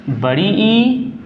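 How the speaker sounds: बड़ी ई with ई drawn out long; long ई demo. Shown as a demonstration. Teacher slowly sounding long vowel ई for students. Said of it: "दीर्घ स्वर".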